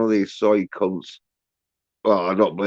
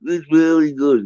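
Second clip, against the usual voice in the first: high, squealing mock fanboy gush; mocking soy fans; 12 semitones higher, 5 dB louder, slower than usual. Mocking fans to his viewers, much higher squealing gush. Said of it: "They'll be".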